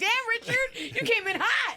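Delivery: mock shocked reaction. Host reacting to Pryor's rude remark, high mock shock. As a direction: yelp it like a startled pal. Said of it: "You're like".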